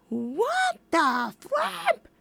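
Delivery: high, slow astonished exclaim; stunned disbelief; surprised. Real, not acted. Surprised at cast member.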